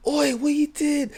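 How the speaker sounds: shop worker shouting. Retold or imitated. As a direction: call out like a shop worker spotting a thief. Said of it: "one of the shop workers say".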